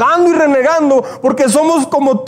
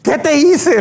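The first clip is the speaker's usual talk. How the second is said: higher, pleading outcry at God; a castaway cries out to God over his burning house, higher and anguished; mock cry to heaven; 5 semitones higher, slower than usual. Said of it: "le grita a Dios".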